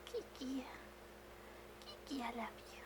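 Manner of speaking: soft, whispered love to a pet; tender to cat. Real, not acted. Owner whispers affectionately to her cat Kiki, tender. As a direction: murmur sweetly to the cat.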